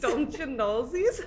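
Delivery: slow, drawn-out mock Minnesota accent; mimicked Minnesota accent. Teasing friend echoing the phrase, mock Minnesota accent. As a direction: echo it with stretched Minnesota o's. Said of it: "the oohs come out a little more".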